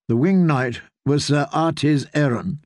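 ordinary delivery, nothing marked

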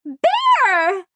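high, loud, drawn-out squeal; shrieky excited call, way up high; delighted squeal. A narrator's reading. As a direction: squeal the name with glee. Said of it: "Kizzy squealed".

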